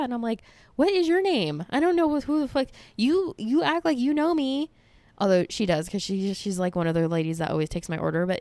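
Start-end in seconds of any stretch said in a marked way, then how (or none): 0.8-4.7 high, incredulous complaint; baffled protest, pitched up; mock exasperation. Mock exasperation at overfamiliar barista.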